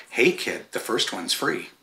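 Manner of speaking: hushed, sly dealer offer; mock dealer. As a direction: slip it under your voice like a pusher. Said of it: "It's sort of like".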